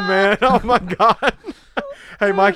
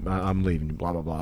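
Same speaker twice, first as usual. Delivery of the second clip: mumbled wife quote; 10 semitones lower, 11 dB quieter than usual. Retold or imitated. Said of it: "She was like".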